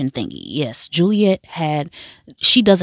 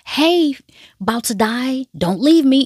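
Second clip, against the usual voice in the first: higher, hammed-up Sawyer teasing; mock Sawyer nickname; 8 semitones higher than usual. Sawyer nicknaming dying Juliet, higher mock plea. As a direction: toss the nickname up high, mocking. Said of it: "he has got a nickname for you".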